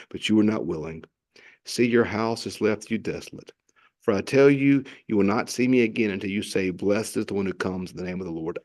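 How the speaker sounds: low, mournful reading of a lament; mournful Bible reading; scripture reading. Acted. Reads lament passage.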